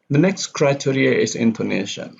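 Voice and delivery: usual speaking voice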